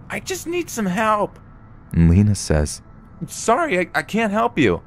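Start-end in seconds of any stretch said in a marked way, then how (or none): 0.1-1.4 high-pitched imitation of a woman yelling; a restrained, much higher pretend cry for help; mock yell. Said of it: "The woman yells". 3.2-4.8 much higher put-on woman's voice; imitated flustered mom. Retold panicked refusal to stranger.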